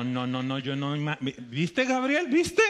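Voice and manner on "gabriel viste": high excited cry out; pitched-up excited shout; excited call. Real, not acted. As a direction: call out, thrilled. Excited preacher calling out.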